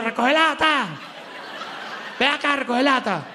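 a hint of scornful shouting at a can picker; mocking scornful call. (burla despectiva llamando a un recogelatas, apenas marcada)

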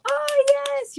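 high delighted yes; light up at the question; excited yes. Real, not acted. Answering a fan about her interview, high excited.

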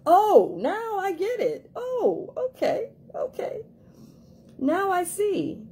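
high, slow, half-crying discovery; high teary aha moment; tearful realization. Retold or imitated. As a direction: act out the penny dropping, high and teary. Voicing a sudden realization.

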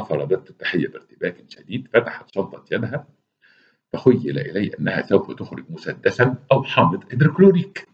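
usual speaking voice

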